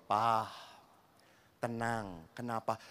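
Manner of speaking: soft, low, unbothered reply; calm him down, totally cool; calm and quiet. Acted. Wife calmly answering her angry husband, soft and low. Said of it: "istrinya dengan tenang ngomong".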